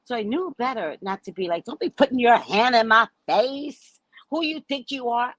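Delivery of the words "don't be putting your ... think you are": raised-pitch confrontational retort; mock indignant challenge. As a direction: challenge her as if squaring up. Imagined retort in the quarrel.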